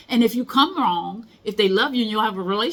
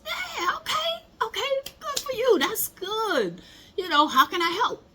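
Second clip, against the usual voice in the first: much higher, bubbly mock cheerleader voice; cheering friend; 13 semitones higher than usual. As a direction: hype her up like a delighted friend. Voicing a cheering supportive friend.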